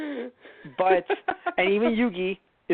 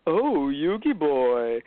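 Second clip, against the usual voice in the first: slow, drawn-out, showy purr of a villain; flamboyant early Pegasus; much slower than usual. Retold or imitated. Mocking the early dub's villain, slow and flamboyant. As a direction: stretch it out like a flamboyant villain teasing. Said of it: "you hear early Pegasus".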